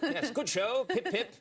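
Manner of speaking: stage Englishman being chipper; laughing mock British accent. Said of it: "I would just say pip".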